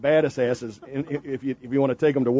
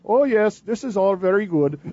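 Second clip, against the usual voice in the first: higher-pitched mock Indian accent; 4 semitones higher than usual. Host voicing his friend's parents in church, Indian accent. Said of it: "they're just going".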